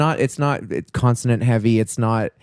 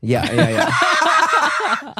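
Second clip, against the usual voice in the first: high, slow squeaky yeah; silly high-pitched cartoon yeahs; cartoon kid voice; much slower than usual. Mock cartoon voice.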